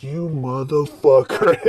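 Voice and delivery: slow, drawn-out mock curse; mock outraged friend. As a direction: stretch it out like a betrayed buddy. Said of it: "He was like".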